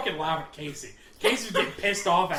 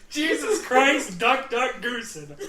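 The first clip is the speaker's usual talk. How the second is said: exasperated laugh; 4 semitones higher, 4 dB louder, slower than usual. Retold or imitated. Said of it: "I'm like".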